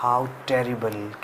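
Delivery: hushed, slow, scared How terrible; frightened. Shown as a demonstration. Teacher showing fear during a storm, hushed and slow. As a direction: breathe it out as if thunder just struck. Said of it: "खतरनाक डर लग रहा".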